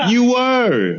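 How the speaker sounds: high, sing-song "you were"; teasing accusation. Real, not acted. Teasing his sister for eavesdropping, high mock accusing.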